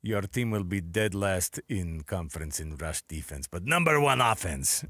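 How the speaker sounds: put-on slow, low fortune teller voice with an accent; Eastern European psychic lady voice. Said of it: "some wild fortune teller came up to you and goes".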